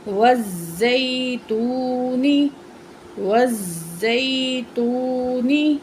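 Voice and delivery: every sound stretched very slowly; slow blended reading. Shown as a demonstration. Teacher joining syllables into a word for the pupil, very slow. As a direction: draw the joined word out carefully. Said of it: "تو جوڑ کرتے ہیں اس کا".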